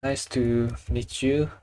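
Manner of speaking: ordinary talk, nothing marked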